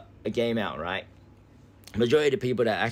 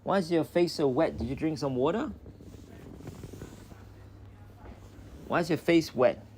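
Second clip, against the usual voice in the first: puppy talk; 7 semitones higher than usual. Real, not acted. Asking the puppy about wet face.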